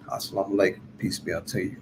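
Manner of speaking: ordinary talk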